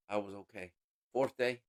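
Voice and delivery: ordinary delivery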